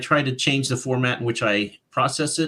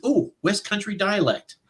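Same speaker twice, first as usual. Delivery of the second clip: dawning realization; 8 semitones higher than usual. Retold or imitated. Retelling discovery of the right dialect.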